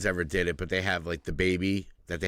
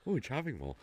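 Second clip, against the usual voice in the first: put-on cooing to the baby; 5 semitones higher, 8 dB quieter than usual.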